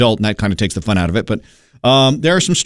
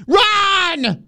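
shouted command. Acted. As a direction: bark it out like a starter. Start signal for contestant.

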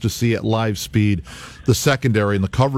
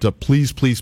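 normal talking voice

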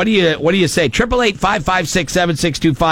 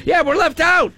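squeaky, much higher mock complaint; mock whine; 13 semitones higher than usual. Host mocking the husbands' complaint, squeaky mock whine. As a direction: whine it up high like a left-out kid.

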